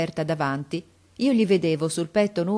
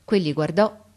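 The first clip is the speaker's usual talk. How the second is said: usual speaking voice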